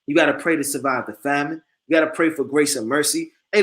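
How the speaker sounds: usual speaking voice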